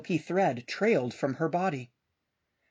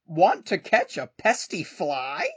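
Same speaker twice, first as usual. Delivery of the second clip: slow rooster voice; 4 dB louder than usual. Acted. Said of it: "crowed the rooster".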